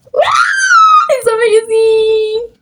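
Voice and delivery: loud, high, slow it's amazing; excited shout. Real, not acted. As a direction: shout it out, thrilled. Praising own drawing.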